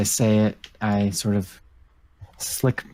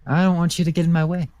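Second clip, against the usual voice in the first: fast, high threatening voice; 4 semitones higher, much faster than usual. Acted. Character threatens at gunpoint.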